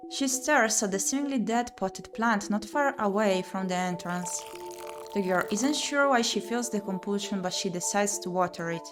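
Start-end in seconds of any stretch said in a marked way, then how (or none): none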